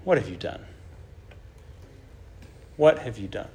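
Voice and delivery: low, soft, sorrowful question; grieved voice of God. Acted. God confronting Cain, low and sorrowful. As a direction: ask it as God grieving the murder. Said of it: "And so God says".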